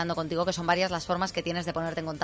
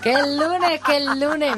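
loud, high mock cheer; echoing shout; 9 semitones higher, 8 dB louder, much slower than usual. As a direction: belt it back like the caller. Host echoes caller's cheer.

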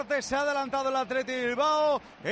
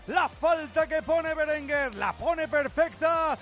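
goal commentary; 3 semitones higher, slower than usual. Real, not acted. Live goal description.

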